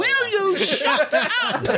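high, drawn-out playful shriek; mock exasperation. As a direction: scold the hecklers in pretend outrage, laughing. Interrupted reader mock-scolds the hecklers.

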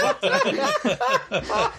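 very high mock-startled cry, laughing. Re-enacting seeing a figure in the room, mock startled.

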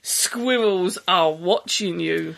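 low, slow creepy warning; hammed-up sinister postscript; mock ominous. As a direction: drop low and draw out the threat. Mock-ominous postscript read aloud.

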